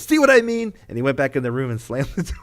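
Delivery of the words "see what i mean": smug vindication. Retold or imitated. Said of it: "And he goes".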